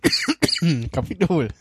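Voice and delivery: coughing after swallowing wrong; choked-up voice mid-cough; choking on a drink. Real, not acted.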